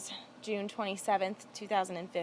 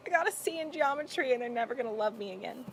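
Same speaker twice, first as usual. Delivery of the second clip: put-on high, panicky wail of a scared teen; mock despair over a bad grade; panicked teen; 5 semitones higher, faster than usual. Teen confessing her C to Grandma, panicky. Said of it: "I was like".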